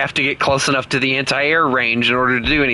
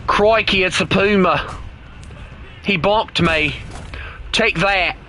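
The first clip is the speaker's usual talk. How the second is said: higher, hammed-up Australian accent; put-on Australian accent; 4 semitones higher than usual. Player spotting an enemy Puma, mock Australian accent. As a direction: exclaim like a cartoon Australian. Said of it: "using an Australian accent that oddly be inaccurate".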